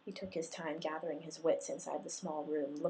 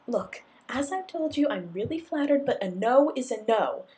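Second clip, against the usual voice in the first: loud, high, fed-up refusal; exasperated, uneasy; 7 semitones higher, 10 dB louder than usual. A narrator's reading. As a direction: push him off firmly but uneasy. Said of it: "half exasperated and more than a tinge uneasy".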